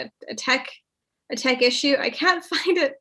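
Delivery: ordinary delivery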